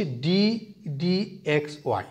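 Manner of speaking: "d", a clear pause, then "dx y"; careful demonstrated pronunciation. Shown as a demonstration. Teacher showing students how to say the notation, "d" held apart from "dx y". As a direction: set "d" apart before saying "dx y". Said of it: "উচ্চারণটা করতেছি কিভাবে আমরা একটু খেয়াল করব".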